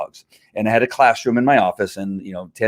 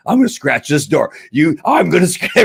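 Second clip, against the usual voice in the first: lightly raised, playful dog voice; scheming dog voice; 7 semitones higher, 4 dB louder than usual. Retold or imitated. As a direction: voice the dog plotting to scratch the door. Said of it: "I went crazy there for a second".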